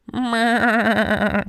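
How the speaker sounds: high wavering bleat; goat bleat. Acted. Dad trying a goat sound for the kids, wavering bleat. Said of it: "Was that a good goat sound?".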